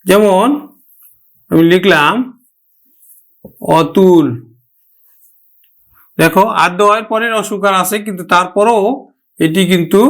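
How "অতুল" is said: অতুল as "aw-tul"; open initial vowel. Shown as a demonstration. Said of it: "অ এর মতো উচ্চারণ হবে". (অতুল-এর অ বিবৃত উচ্চারণ)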